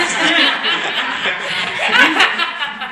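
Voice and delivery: long squealing laugh; real laugh of embarrassment, high; laughing hard at himself. Real, not acted. Laughs recalling the encounter.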